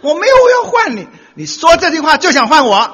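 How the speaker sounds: very high, loud protest; hammed-up high loud quarrel. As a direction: yell the denial desperately. Husband and wife quarrel.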